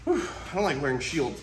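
ordinary delivery

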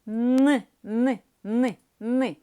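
Н repeated in short, loud, separate bursts; short hard Н. Shown as a demonstration. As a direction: sound out a hard Н, short each time. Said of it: "Короткий звук, твердий". (короткий твердий звук Н, уривчасто)

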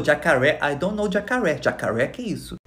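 put-on American accent. American exchange student not knowing the word, American accent. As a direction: mimic a baffled American speaking bits of Portuguese. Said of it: "americana, não sabia o que que era jacaré".

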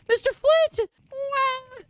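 put-on very high, loud child voice; high-pitched, wailing little boy; rescued boy. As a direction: squeal it like an excited kid.

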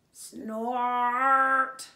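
loud machine snort. Acted. Big digger snorting at the baby bird, loud snort. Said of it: "The big thing said".

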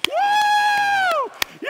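high loud "woo" of a wild classroom; class going nuts. Retold or imitated. Classmates cheer at Diane's name, going nuts. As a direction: imitate kids whooping wildly. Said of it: "the class went nuts".

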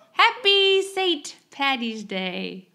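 slow attempt at an Irish accent; weak Irish accent, drawn out. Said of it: "I'm trying to say it with an Irish accent".

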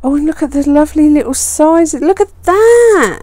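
stretched-out delighted look; excited gush. Real, not acted. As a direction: coo over it with glee. Admiring sticker sizes.

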